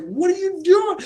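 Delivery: squeaky high-pitched disbelief; high, loud startled What are you doing; shocked mock quote. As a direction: play it as a shrill shocked parent. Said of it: "'cause I was like".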